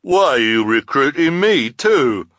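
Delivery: loud, high protesting shout; indignant henchman. Acted. Hook-handed man to his boss from the net, indignant shout.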